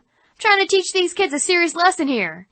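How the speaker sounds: high, put-on annoyed complaint; out-of-character frustration; exasperated scold. Devil scolds laughing teens.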